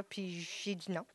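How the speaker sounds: ordinary delivery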